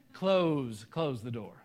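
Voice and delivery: loud, drawn-out Georgia "Close, close the door"; own Southern accent. Retold or imitated. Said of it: "they would make fun of me for this".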